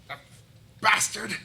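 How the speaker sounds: high, loud mock-angry curse; hammed-up indignant yell; outraged outburst. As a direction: spit out the insult at full voice. Tower man cursing old boss.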